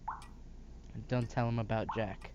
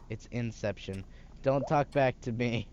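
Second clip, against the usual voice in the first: usual speaking voice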